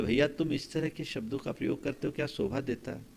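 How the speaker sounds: very soft, private admonishing; hushed gentle reproof. Retold or imitated. Monk reproving a man alone, hushed and gentle.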